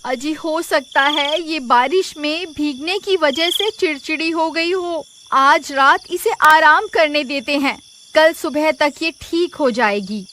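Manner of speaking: calm him down gently; wife soothes her husband about the buffalo, reassuring. Acted.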